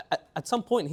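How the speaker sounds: normal talking voice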